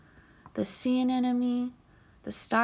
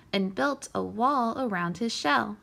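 ordinary delivery

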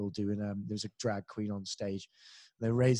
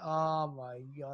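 mock dismay; 4 dB quieter, much slower than usual. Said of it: "And I went".

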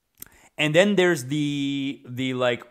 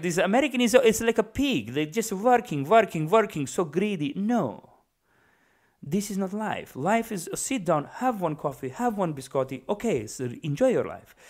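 higher-pitched, hammed-up Middle Eastern accent; 5 semitones higher than usual. Old-country man scolding overworked Americans, Middle Eastern accent. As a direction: lecture them in a put-on Middle Eastern accent. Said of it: "I'm just giving him a Middle Eastern accent".

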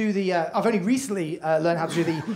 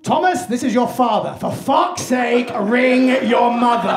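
mock dad's raised-voice message; higher, drawn-out paternal exasperation; exasperated father; 4 semitones higher, 4 dB louder, slower than usual. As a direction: bellow slowly, fed up, on voicemail. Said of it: "one final answer phone message".

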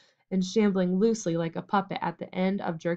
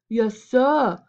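louder, higher shout of yes sir; mock yell; 4 semitones higher, 6 dB louder than usual. A narrator's reading. Major shouting in his servant act, louder. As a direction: bellow it like a groveling servant. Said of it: "yelled Major".

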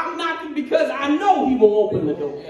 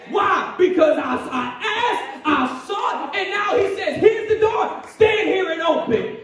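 getting excited; 2 semitones higher than usual. Real, not acted. Pastor urging the congregation to keep knocking, excited. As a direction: let the joy of the promise lift every line. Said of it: "I'm getting excited".

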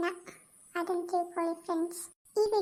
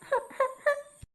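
a high, breaking sob; 7 semitones higher than usual. Acted. Mother-in-law sobs over Pooja, high and breaking. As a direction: let out one high sob.

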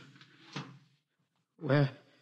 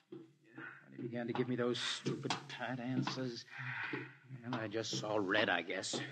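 quiet regret; 6 semitones lower, 11 dB quieter than usual. Acted. Quiet shamed confession.

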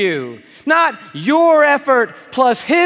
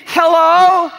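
calling hello; 5 semitones higher, 4 dB louder than usual. Acted. Jesus calling out unheard.

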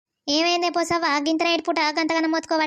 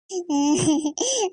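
sob. Acted. Daughter sobbing over father.

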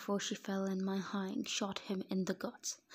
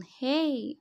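loud, high drawn-out whine; raised-pitch indignant whine; whined protest; 5 semitones higher, 10 dB louder than usual. A narrator's reading. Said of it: "I whined".

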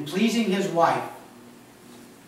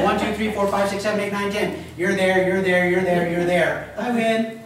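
1 playful counting; 5 semitones higher, 6 dB louder than usual. Pretend. God playing hide and seek.

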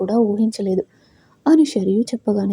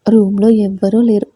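ordinary delivery

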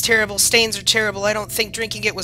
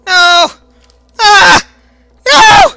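shrill loud screams of no; terrified screaming; 9 semitones higher, 12 dB louder, much slower than usual. Real, not acted. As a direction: scream in total panic. Monster catches her.

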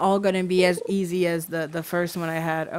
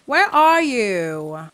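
long drawn-out, louder call; 2 semitones higher, 6 dB louder, much slower than usual. Real, not acted. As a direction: stretch it out, calling for a missing friend. Calling for missing friend.

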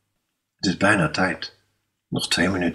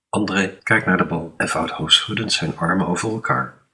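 ordinary delivery, nothing marked

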